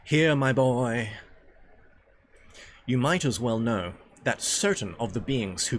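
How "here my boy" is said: drawn-out, louder character voice; reading a character's address. Acted. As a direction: call out slowly to the boy.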